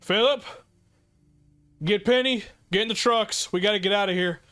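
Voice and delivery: high, loud shouted orders to flee; shouting orders. Acted. Gunman yelling at his crew to flee the attack, loud and high. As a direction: yell at his people to get to the trucks. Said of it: "the man who shot just go".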